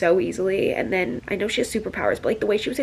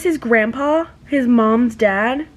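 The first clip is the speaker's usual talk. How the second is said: ordinary delivery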